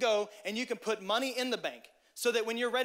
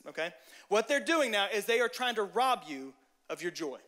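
ordinary talk, nothing marked

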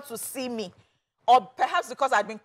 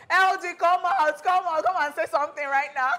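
calling out; 7 semitones higher than usual. Retold or imitated. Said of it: "They were like".

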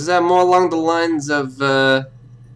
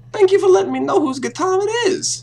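much higher, squeaky mock gratitude; mock high-pitched thanks. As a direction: jump way up into a cartoonish voice to mock her. Sarcastic detective mocking the suspect.

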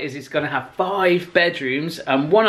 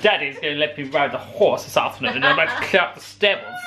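hammed-up posh English accent; teasing upper-class English put-on; mock posh. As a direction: play a posh horsey daughter. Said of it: "You should talk like that more often".

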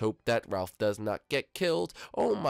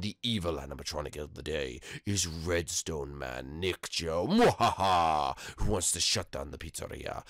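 sneering panto villain; 7 semitones lower than usual. Acted. Introducing evil animatronic villain.

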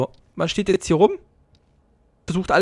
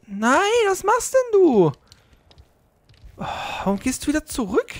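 dismayed squeal; 12 semitones higher than usual. Real, not acted. Player cries out as his companion turns back, in high dismay. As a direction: wail at it in disbelief.

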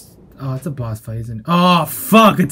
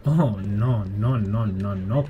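subdued dismay; 8 semitones lower, 6 dB quieter, slower than usual. Real, not acted. Weary dismay after tripping in game.

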